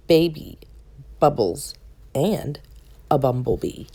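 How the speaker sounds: usual speaking voice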